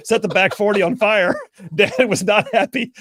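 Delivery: high, loud reading through laughter; laughing. Real, not acted. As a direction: crack up while reading aloud. Laughs while reading listener's story.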